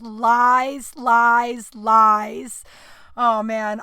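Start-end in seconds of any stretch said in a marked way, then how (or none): 0.0-2.6 hammed-up poem recital, drawn out; a melodramatic poem voice, lies repeated; mock dramatic reading. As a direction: read it like angsty teen poetry. Reading her old dramatic poem.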